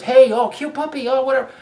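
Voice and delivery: mocking a girl on the street cooing at his puppy. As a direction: imitate a girl squealing over a cute dog. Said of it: "she goes".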